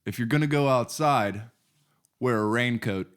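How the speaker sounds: low, measured fatherly advice; very calm. Retold or imitated. Dad advising son at the computer, very calm. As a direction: deliver it calmly, pausing before the punchline. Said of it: "he said to me very calmly".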